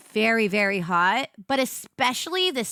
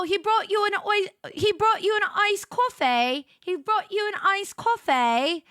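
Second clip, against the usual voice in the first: higher mock British gossip; light fake British accent; Love Island British accent; 5 semitones higher than usual. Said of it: "what was some of the other things they say".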